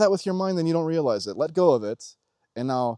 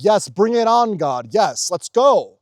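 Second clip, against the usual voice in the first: louder, higher hyped yes; pumped louder, higher battle cry; mock eager cheer; 7 semitones higher, 7 dB louder, slower than usual. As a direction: cheer it on like you're ready for anything. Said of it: "You're like".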